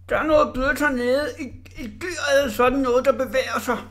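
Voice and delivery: scared. Acted. Scared Orla in the cannon.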